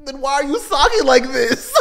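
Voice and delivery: high, loud, laughing retort; mock exasperation. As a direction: throw it back in playful disbelief. Acts retort to angry parent.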